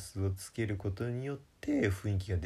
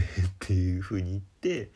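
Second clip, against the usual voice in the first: usual speaking voice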